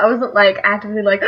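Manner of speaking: usual speaking voice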